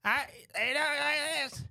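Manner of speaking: high, slow put-on old man voice; drawn-out, high-pitched grandpa impression; tiny old man. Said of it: "this little tiny old guy".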